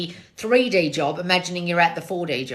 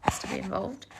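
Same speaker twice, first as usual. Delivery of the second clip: whispered aside; 10 dB quieter than usual. Real, not acted. Host whispering about the cat who wants attention. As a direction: hush it under your breath.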